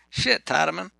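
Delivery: put-on cocky young guy. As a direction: swagger like a young ex-con. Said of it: "He said".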